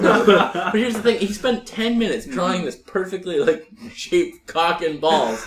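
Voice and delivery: laughing through the story; giggly storytelling. Real, not acted. Laughing while telling story.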